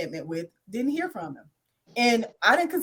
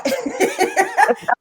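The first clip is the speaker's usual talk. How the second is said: high, loud burst of laughter; real laugh; 13 semitones higher, 6 dB louder than usual. Real, not acted. Laughing while explaining her experience, high real laugh. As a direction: crack up mid-sentence, high and loud.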